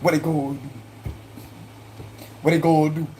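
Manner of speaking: run-together Cajun question; Cajun-accented "gonna". Shown as a demonstration. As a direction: show off your Cajun accent. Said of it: "That's the Cajun right there".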